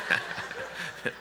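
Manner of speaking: breathy laugh after teasing; soft breathy laugh. Real, not acted. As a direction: laugh under your breath. Laughing at own joke.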